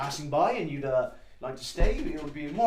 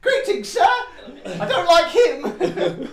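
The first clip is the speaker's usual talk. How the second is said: high, loud Cockney lad voice; Cockney boy; 9 dB louder, much slower than usual. Acted. Small lad greets the party in a loud Cockney voice. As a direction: shout it like a cheeky Cockney kid. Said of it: "I talk like a Cockney".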